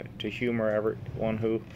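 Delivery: normal talking voice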